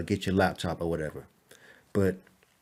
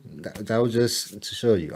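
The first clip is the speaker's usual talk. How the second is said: normal talking voice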